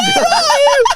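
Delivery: high squeaky voice acting tough; a pipsqueak trying to sound hard, laughing; squeaky fake bravado. Lad mimicking his tiny younger self threatening a caller, squeaky.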